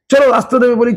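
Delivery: usual speaking voice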